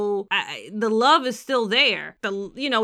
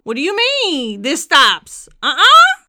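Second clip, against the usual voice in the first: high, loud indignant refusal; mock outrage; 5 semitones higher, 10 dB louder than usual. Real, not acted.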